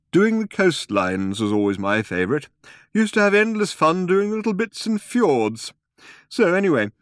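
put-on wistful old man. A narrator's reading.

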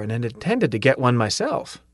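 plain reading voice

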